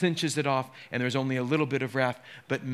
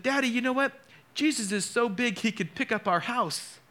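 lightly voiced little girl, higher; a hint of a four-year-old's proud announcement; little daughter; 9 semitones higher than usual. Pastor retells his little daughter, hint of a child's voice.

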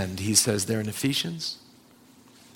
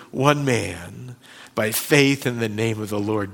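ordinary talk

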